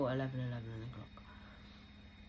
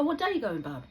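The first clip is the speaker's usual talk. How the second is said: much higher and louder eager ask; bright, pushy offer of help; retelling her own keen question to a friend; 6 semitones higher, 8 dB louder than usual. Retold or imitated. Voicing herself, keen to help.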